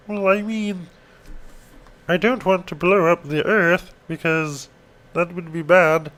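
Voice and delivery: high, slow cartoon alien voice; squeaky high Martian, dragged out; Marvin the Martian. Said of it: "That was my Marvin the Martian impression".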